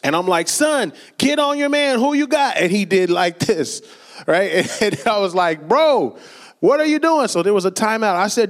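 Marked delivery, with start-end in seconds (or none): 0.5-2.6 raised-pitch urgent coaching call. Retold or imitated. Dad coaching his son mid-game, urgent call. 5.7-7.3 higher, incredulous scolding; exasperated coach. Retold or imitated. Dad coaching his spaced-out son, exasperated.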